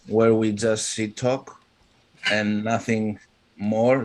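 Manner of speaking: ordinary delivery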